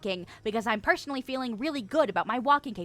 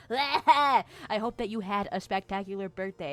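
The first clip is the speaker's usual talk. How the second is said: careful retake. Shown as a demonstration. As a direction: say it again properly, taking care over "birthday". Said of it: "Talk correctly, May".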